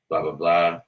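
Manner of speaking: ordinary delivery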